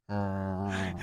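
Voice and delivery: low, soft drawn-out groan; quiet mock crowd groan. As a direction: imitate a crowd's low, unimpressed "uh". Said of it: "The crowd just goes".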